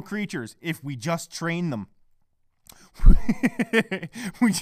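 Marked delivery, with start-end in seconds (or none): 3.0-4.3 loud, bursting laugh; cracking up. Real, not acted. Podcaster amused by his own training claim, cracking up. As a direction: break into laughter at your own line.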